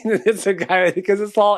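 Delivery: ordinary talk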